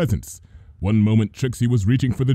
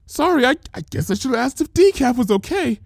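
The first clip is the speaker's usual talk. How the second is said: high, timid apology; fearful high baker voice; scared Mrs Cake; 13 semitones higher, faster than usual. Acted. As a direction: back away nervously while apologizing. Mrs Cake edging away afraid.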